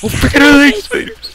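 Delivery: high, very loud startled scream; real panicked outburst; spider panic. Real, not acted. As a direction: shriek in alarm at spiders.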